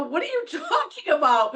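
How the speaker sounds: high-pitched, baffled comeback; retold indignation. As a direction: protest in disbelief, half laughing.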